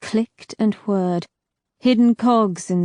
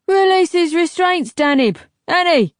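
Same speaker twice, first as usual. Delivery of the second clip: loud, higher commanding call; barked orders; 10 semitones higher, 5 dB louder than usual. A narrator's reading. Temza shouting orders to his thugs, loud. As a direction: order the thugs sharply across the room.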